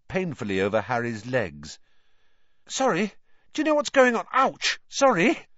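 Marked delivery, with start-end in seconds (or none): 2.7-5.5 high-pitched, bumbling apology; flustered boy. A narrator's reading. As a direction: blurt it out while stumbling over people. Flustered boy stumbling in darkness.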